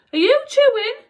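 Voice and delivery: high, loud howled question; howling. A narrator's reading. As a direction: howl it down the corridor. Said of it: "she howled down the corridor".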